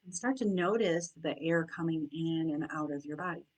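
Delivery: soft, unhurried meditation voice with long pauses; guided meditation. Acted. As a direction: guide them gently into noticing their breath and senses.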